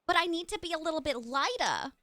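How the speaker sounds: light, playful New York character accent; Harley Quinn-esque accent. Shown as a demonstration. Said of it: "a Harley Quinn-esque accent".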